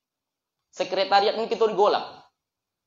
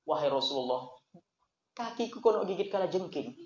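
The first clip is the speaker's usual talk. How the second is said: much softer, humble admission; soft confession; 4 semitones lower, 9 dB quieter than usual. Acted. Abu Bakar softly tells the Prophet of the sting. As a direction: confess it quietly, head bowed.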